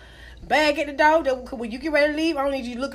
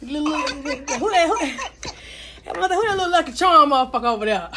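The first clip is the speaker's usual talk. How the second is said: laughing; 3 semitones higher, 3 dB louder than usual. Real, not acted. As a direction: crack yourself up. Laughing at the joke.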